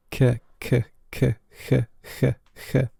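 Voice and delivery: K turned into a breathy hiss, k, k; hissy lenited K. Shown as a demonstration. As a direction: let the k in "K, k" soften to a throaty hiss. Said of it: "The C has turned into something like a hiss".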